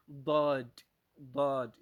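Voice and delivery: "ضاد" loud, heavy first sound and clear final D; teacher models emphatic "ضاد"; Daad with final D. Shown as a demonstration. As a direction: punch "ضاد", keeping the last D. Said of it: "ولا تنسى الحرف الأخير في الضاد".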